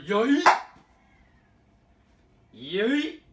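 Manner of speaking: loud high yell. Real, not acted. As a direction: shout it as you toss. Tossing the empty packet.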